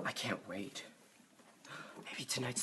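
soft eager whisper. Acted. John whispering to Mary about meeting Julia, eager whisper. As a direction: breathe it out, thrilled.

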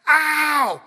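loud sudden cry of pain; pained yelp. Retold or imitated. Pastor reliving his son's bite, loud pained yelp. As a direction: yelp as if bitten on the thigh. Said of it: "I was like".

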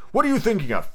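high, stern demand; severe scolding. A narrator's reading. The mouse questions inattentive Alice, severe. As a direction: demand it like a cross teacher. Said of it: "said the mouse to Alice severely".

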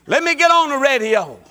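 bursting out with a laugh; excited, laughing loud. Acted. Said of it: "Huh, I feel it".

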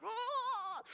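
high mock wail of despair; anguished cry. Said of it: "Like, really, like".

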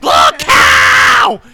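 shrieked high and loud like a golem; shrieking. Retold or imitated. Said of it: "shrieking like a fucking golem".

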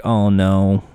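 low, weary sorry to the host; mock defeated racer, worn out and low; a hint of tired regret; exhausted apology. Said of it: "they're exhausted".

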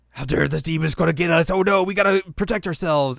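hammed-up fear of the demon; mock panic. Voicing scared villagers.

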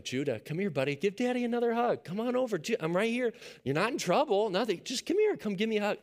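put-on high, sing-song coaxing to a small child; coaxing a toddler. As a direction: gently lure the boy back for a hug. Dad coaxing stubborn toddler over.

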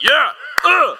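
loud, high-pitched yell and grunt; mock macho roar of approval; rousing dads' cheer. All the dads backing a strict father, hammed-up cheer.

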